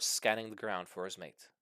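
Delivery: ordinary delivery, nothing marked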